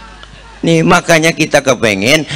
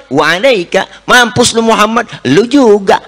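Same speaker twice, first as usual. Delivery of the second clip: mock rude translation; 8 semitones higher than usual. Shown as a demonstration. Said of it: "Begitu artinya".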